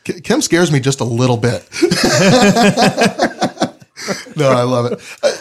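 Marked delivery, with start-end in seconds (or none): none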